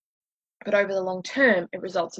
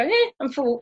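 higher-pitched casual dismissal; mock nonchalance; 6 semitones higher than usual. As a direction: lightly shrug it off, voice lifted. Acting effortless eater declining more food.

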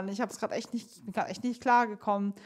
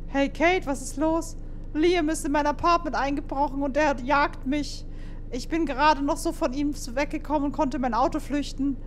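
lightly voiced frightened young woman, a bit higher; scared Kate; 5 semitones higher, 5 dB louder than usual. Kate telling grandpa on the phone she is scared. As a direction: play the scared heroine calling for help.

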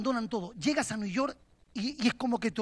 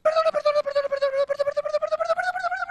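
very high, hammed-up begging for forgiveness; theatrical wailing; 4 dB louder, faster than usual. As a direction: wail dramatically, pouring out repeated pleas. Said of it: "ellas sí saben hacer teatro". (lamento teatral agudo y exagerado)

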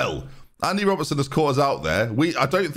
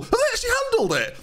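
very high, fast squeal of disbelief; excited squeal; much faster than usual. Real, not acted. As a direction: blurt it out in a shrieking rush.

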